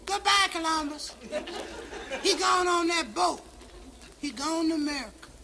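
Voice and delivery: high mock-girlish squeal; lightly hammed-up high fan voice; screaming girls. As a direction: squeal it like a crowd of fans on the pier. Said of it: "They're all screaming".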